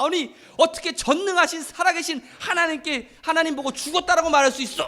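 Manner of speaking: loud, high outburst of indignation; angry shouting. Retold or imitated. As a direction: shout it at your wife in outraged disbelief. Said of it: "막 화를 내면서 막 성질을 내면서".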